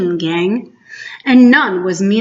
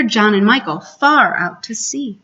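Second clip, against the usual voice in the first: usual speaking voice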